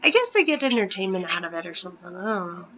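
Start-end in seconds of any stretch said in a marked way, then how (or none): none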